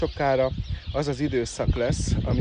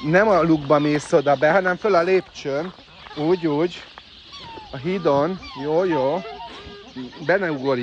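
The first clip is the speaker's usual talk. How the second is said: higher, louder directions to a bird; loud guiding; 5 semitones higher, 6 dB louder than usual. Real, not acted. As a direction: steer it onto the bridge. Guiding the bird onto bridge. (hangos, magasabb irányítás)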